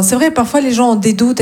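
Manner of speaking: ordinary talk, nothing marked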